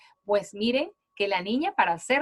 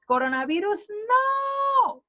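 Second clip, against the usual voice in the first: much higher on no, pushed out; exclaimed rejection; 11 semitones higher, slower than usual. Retold or imitated. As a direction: reject it with a ringing cry. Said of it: "el cuento del coronavirus". (exclamando "no" mucho más agudo, como niño en el cuento)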